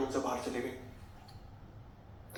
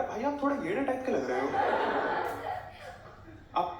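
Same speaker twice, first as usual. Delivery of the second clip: high, sceptical guard voice teasing a stranger; suspicious guard; 8 semitones higher than usual. Retold or imitated. Gate guard eyeing a fake student, high and sceptical. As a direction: play the gate guard, doubtful and mocking.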